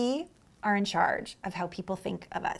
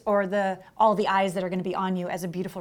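ordinary talk

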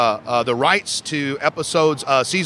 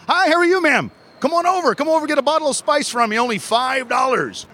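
vendor sales pitch; 8 semitones higher than usual. Acted. Calling customers to his stall.

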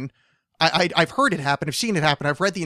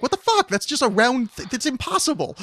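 high-pitched mock outrage; much higher, incredulous outburst; baffled disbelief; 9 semitones higher than usual. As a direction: protest in hammed-up astonishment. Acted baffled reaction to magic trick.

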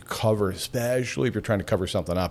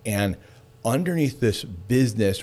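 ordinary talk, nothing marked

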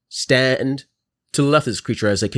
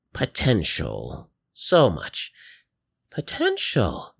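put-on slow, swooping old-woman voice; old lady grandma; 2 semitones lower than usual. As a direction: confide in your chosen ones like a grandma. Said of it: "in an old lady's".

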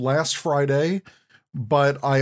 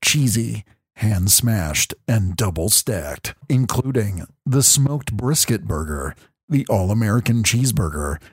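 ASMR voice; 6 semitones lower, 3 dB louder than usual. Shown as a demonstration. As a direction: murmur the menu like ASMR. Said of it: "my A S M R voice".

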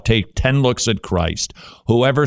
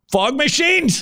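mock guess; slower than usual. Real, not acted. Joking guess to co-host.

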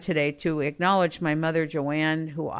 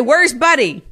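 higher, louder asking voice; mother asking; 8 semitones higher, 7 dB louder than usual. Retold or imitated. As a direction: call out asking for her husband. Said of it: "She would say".

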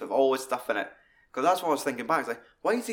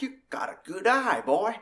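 high-pitched put-on old General voice; Bruce Dern impression; 7 semitones higher than usual. Fan quoting the General addressing Mannix, high put-on voice. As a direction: mimic the film General, pitched well up. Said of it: "Like, fucking Bruce Dern".

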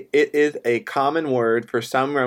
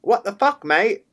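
high-pitched mock-Australian exclamation; Australian accent; 8 semitones higher than usual. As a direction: put on a playful Australian accent. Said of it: "that was my Australian accent".

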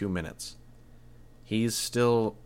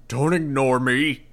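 loud, high, slow angry yell; furious roar; 6 semitones higher, 8 dB louder, slower than usual. A narrator's reading. The mayor raising his gun, roaring at the hero. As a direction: demand to be heard. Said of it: "he roared".

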